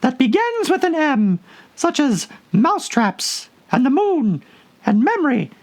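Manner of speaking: a tiny creature answering after a long think; squeaky Dormouse. A narrator's reading. The Dormouse rambles on.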